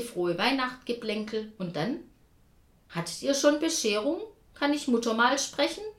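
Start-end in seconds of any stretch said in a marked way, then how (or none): 2.9-5.8 voiced brother on phone. A narrator's reading. Klaus phoning sister, character voice.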